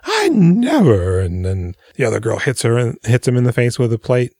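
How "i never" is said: slow, much higher indignant gasp; scandalized lady. Retold or imitated. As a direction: drag it out, deeply offended. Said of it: "She starts clutching her pearls".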